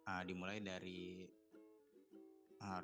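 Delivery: normal talking voice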